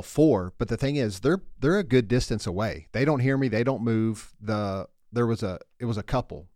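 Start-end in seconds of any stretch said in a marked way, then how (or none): 0.0-0.5 calling out Four across the course, loud; retold golf yell. Golfer yelling a warning to distant players, loud call. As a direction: shout a warning to the far-off couple. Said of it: "And I yell".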